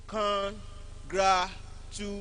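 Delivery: CON, GRA, TU drawn out slowly, one at a time; careful syllable-by-syllable. Shown as a demonstration. Teacher showing where strength is needed, slow separated syllables. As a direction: stretch each syllable of CONGRATU with a gap after it. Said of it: "more strength".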